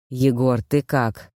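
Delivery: low-pitched voice of a concerned father; worried old father. A narrator's reading. Old Koschei checks on his son, worried. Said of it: "Он встревоженно вгляделся".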